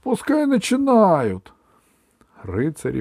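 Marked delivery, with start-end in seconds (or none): 0.0-1.5 high, slow, loud yell; impatient shout. Acted. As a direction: shout from the stands. Crowd shouting from stands.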